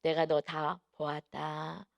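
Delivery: slow, measured imitation of God speaking. God calls the pastor by name, retold slow solemn voice. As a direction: draw the words out slowly, as God speaking. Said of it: "이름 부르시면서".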